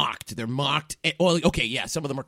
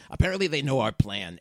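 normal talking voice

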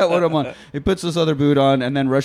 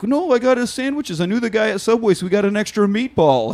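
put-on high, excitable boyfriend voice; eager boyfriend; 9 semitones higher than usual. Boyfriend to girlfriend walking out, eager pleading. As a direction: plead in a raised, mock-eager pitch.

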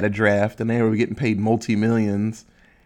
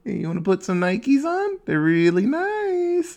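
cult recruiter retake. Retold or imitated. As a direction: sell the sneakers like a sweet-talking cult member. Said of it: "Damn it, I messed up the joke".

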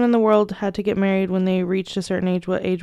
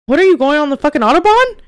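shocked outburst; 6 semitones higher, 7 dB louder than usual. Real, not acted. Shocked at driving ninety.

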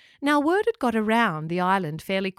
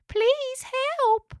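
very high, tiny pleading voice; little cry. A narrator's reading. Echidna pleads for help from the bush, little high cry. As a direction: plead like a small stuck animal. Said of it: "came a little cry".

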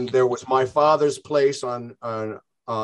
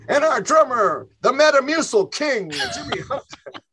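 hammed-up emcee intro, much higher and laughing; mock stage introduction; 12 semitones higher than usual. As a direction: announce the drummer like a cheeky showman through laughter. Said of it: "And they go".

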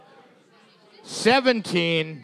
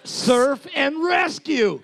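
excited title shout; 7 semitones higher, slower than usual. Real, not acted. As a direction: announce it like a TV show title. Excited pitch of show idea.

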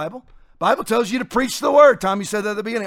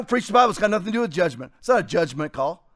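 ordinary delivery

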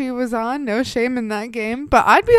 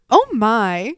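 scandalized, a touch louder; prim shock; 6 dB louder than usual. Retold or imitated. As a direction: clutch your pearls. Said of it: "She just goes".

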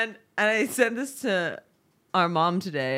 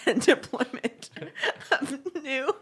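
laughing; 7 semitones higher, slower than usual. Acted. Laughing parody of the jargon.

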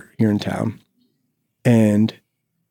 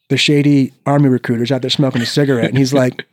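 usual speaking voice

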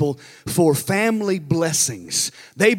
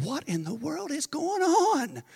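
bewildered thought; 8 semitones higher, 7 dB quieter, slower than usual. Retold or imitated. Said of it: "I'm thinking".

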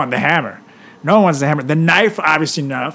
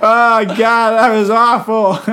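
laughing; 5 semitones higher, 5 dB louder, slower than usual. Real, not acted. Laughing reaction to bad reading.

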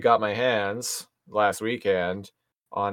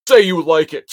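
high, louder demand; bully demand; 7 semitones higher, 8 dB louder than usual. Retold or imitated. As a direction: order it like a bully.

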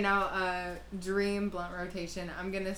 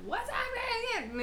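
mock squeal to greet a favorite cousin; excited greeting; slower than usual. Acting out their usual excited greeting.

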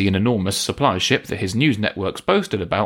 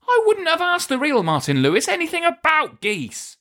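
exasperated. Real, not acted. Exasperated protest.

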